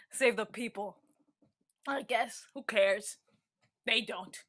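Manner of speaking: low, soft, bitter and resigned; bitter murmur. Acted. Character grumbling to herself about the people, low. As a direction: mutter it like you've given up.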